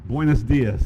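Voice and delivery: buenos dias said in careful schoolbook Spanish; Spanish greeting. Shown as a demonstration. Speaker greets the room in Spanish, a learner's try. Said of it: "this is the only word I remember".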